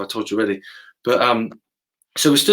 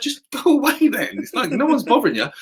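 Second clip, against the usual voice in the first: mock exasperated; 12 semitones higher than usual. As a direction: put on a high-pitched fed-up voice. Acted exchange with exasperated heckler.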